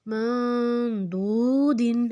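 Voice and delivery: slow مندودن with n hummed long in the nose; nasal ghunna. Shown as a demonstration. Teacher gives next ghunna example, drawn-out nasal n in مندودن. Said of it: "ناک میں تھوڑی آواز لے کے جائیں گے".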